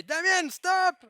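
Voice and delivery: high, loud cry for help. Shown as a demonstration. Speaker showing audience how to interrupt him, crying out loud. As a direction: shout it out to halt the talk. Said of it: "cry aloud and say".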